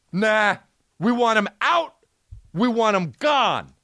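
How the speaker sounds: high-pitched, unforgiving demand; mock outraged mob. Host playing the cancel crowd wanting him gone, mock-indignant. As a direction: dig in and refuse to hear it.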